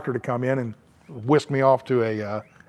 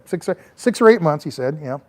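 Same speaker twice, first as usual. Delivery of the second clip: ordinary delivery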